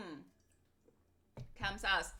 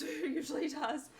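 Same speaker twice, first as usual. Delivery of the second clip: ordinary talk, nothing marked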